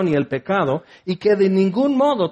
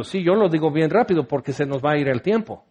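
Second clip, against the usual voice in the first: quick, hurried explanation; speaking fast because time is short; bien rápido; 2 semitones lower, faster than usual. Real, not acted. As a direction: rattle it off to save time. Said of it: "tú lo dices bien rápido".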